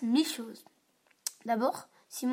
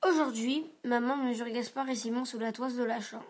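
plain reading voice

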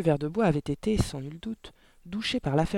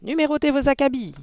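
parrot voice; 11 semitones higher, 8 dB louder than usual. A narrator's reading. Parrot showing off its second phrase, loud squawk. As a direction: call it out like a talking parrot. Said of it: "avec d'étonnante intonation caverneuse".

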